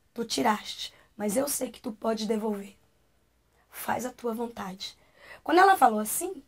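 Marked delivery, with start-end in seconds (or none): 0.1-5.0 low, soft prayerful surrender; retold humble prayer, voice sinking; hushed prayer. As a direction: pray quietly, slowing at the end.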